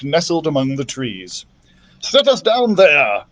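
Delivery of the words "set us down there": cry. Acted. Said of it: "cried Doctor Drake".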